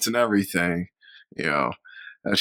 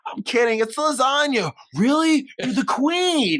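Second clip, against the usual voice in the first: mock quote; 12 semitones higher, slower than usual. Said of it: "He's like".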